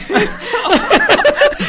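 high squealing burst of laughter. Real, not acted. Teacher laughs after rushing through the French line, high burst of laughter. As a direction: crack up at having rushed through it. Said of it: "读完了".

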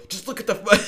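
high, loud, laughing plea; flustered urging. Retold or imitated. Boyfriend halfway down on one knee redirects girlfriend, flustered urging. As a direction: blurt it out urgently, breaking into laughter. Retold urging during the proposal.

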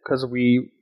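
ordinary talk, nothing marked

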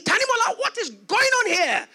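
upset father. Retold or imitated. Upset father summoning his son, loudly demanding. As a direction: call the son to account, acting it out. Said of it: "he was so upset".

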